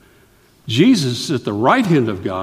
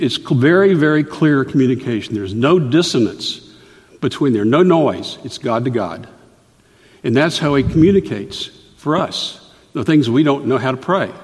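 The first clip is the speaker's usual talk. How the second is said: ordinary delivery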